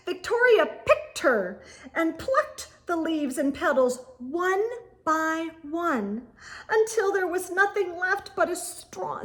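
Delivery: ordinary delivery, nothing marked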